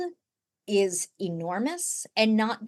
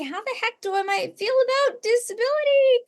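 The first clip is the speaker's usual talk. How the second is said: much higher, fast, fretful self-question; anxious inner voice; 13 semitones higher, 4 dB louder, faster than usual. Acted. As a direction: voice the panicked new parent. Voicing worried parent's inner question.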